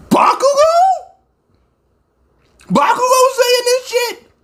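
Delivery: high, cracking cry of disbelief; can't believe what he just heard; a high shouted cry of shock; stunned disbelief. Real, not acted. Stunned by Bakugo's line.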